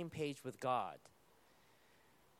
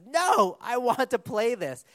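put-on high, loud whiny child protest; preacher voicing a child refusing to give up the iPad, mock tantrum; child's tantrum; 6 semitones higher, 9 dB louder, slower than usual. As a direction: throw a mock tantrum like a kid denied the iPad.

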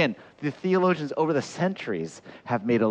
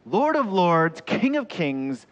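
loud, high, ringing titles; grand proclamation; 6 semitones higher, 4 dB louder than usual. Real, not acted. Preacher building up who Jesus is, grand proclamation. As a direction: proclaim it like a herald.

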